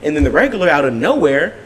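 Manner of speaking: ordinary talk, nothing marked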